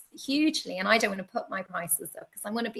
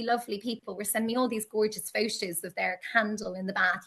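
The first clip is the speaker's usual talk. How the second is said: normal talking voice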